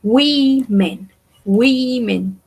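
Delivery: "women" said WEE-men, first vowel stretched; teacher's drawn-out "women", O as I; I sound in women. Shown as a demonstration. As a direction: make the O in "women" a long ee. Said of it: "se va a pronunciar como una I".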